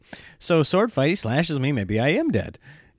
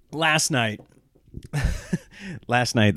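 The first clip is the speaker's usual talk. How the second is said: ordinary delivery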